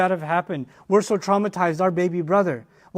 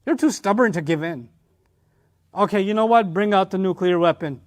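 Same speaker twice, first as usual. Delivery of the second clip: usual speaking voice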